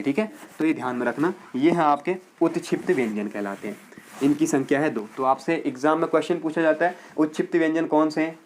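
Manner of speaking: usual speaking voice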